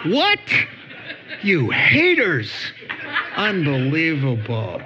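mock shock. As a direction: play scandalised, half laughing. Mock shock at plain truth.

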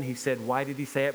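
usual speaking voice

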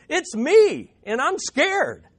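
put-on a child blurting out his fear; scared little boy. Boy frozen on stage in the Christmas play, high and scared. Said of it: "all he could say was".

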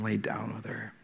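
ordinary talk, nothing marked

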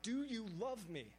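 slow, high "Do you love me"; emphatic plea. Acted. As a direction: lean long on "Do you love me". Climax of Jesus' repeated question.

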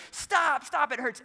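high, loud mimicked cry of pain; pained child's scream, imitated. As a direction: act a small boy yelling to make it stop. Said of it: "he is screaming at the top".

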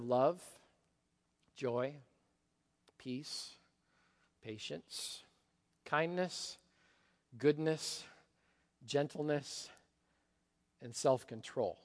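God speaking tenderly, a hint only; gentle list. Acted. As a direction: lay each word down gently. God names the gentle virtues.

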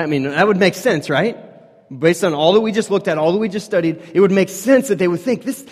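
loud, high preaching voice; fervent sermon climax. Acted. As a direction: build it up to a loud, high peak. Preaching at full force.

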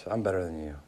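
smug. Retold or imitated. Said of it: "Or there's just, like".

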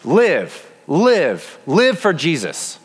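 ringing call, drawn out slowly on the last line; a heavenly crowd cheering someone on; angels crying out. Acted. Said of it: "Angels are crying out".

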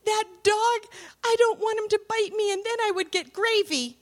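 high, loud, quick frightened wail; whimper-shout it like a panicked child; mock-frightened little girl pleading about the dog; scared little girl. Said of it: "She goes".

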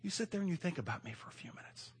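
God praising Newman, hushed and kind; gentle God. Retold or imitated. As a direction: reassure him quietly. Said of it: "God would say".

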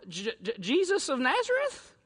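stammering, cracking rise. Retold or imitated. Soldier answering Jesus, voice cracking in fear. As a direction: falter on the J. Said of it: "Voice crack, right?".

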